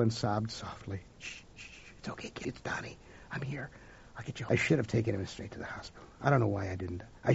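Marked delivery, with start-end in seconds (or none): 1.2-4.5 hushed, soothing whisper; soft whisper. A narrator's reading. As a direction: whisper gently to a hurt child. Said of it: "narrator voices Donnie soothing child".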